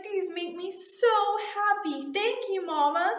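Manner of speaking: put-on high, slow child voice; little-kid gushing, drawn out; happy little girl. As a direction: imitate a delighted small child. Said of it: "she was so excited".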